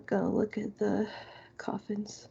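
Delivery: ordinary delivery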